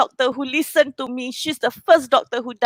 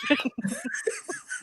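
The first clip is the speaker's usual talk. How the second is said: teary laugh; 13 dB quieter than usual. Real, not acted. Laugh after tearful outburst.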